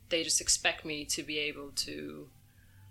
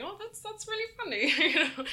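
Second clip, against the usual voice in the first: much higher mock polite chuckler; lone laughing fan; 13 semitones higher, 3 dB louder than usual. Audience member praising the joke, high and mock polite. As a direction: play the one fan humoring you. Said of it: "one person in the crowd sort of laughing".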